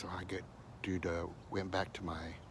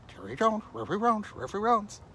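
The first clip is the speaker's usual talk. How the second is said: talking dog; 13 semitones higher, 6 dB louder than usual. Retold or imitated. Dog answers in story.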